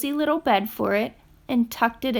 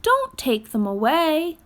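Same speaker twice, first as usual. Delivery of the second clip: higher pleading little voice; Fletcher pleading; 5 semitones higher than usual. Acted. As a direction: beg the squirrel not to take them.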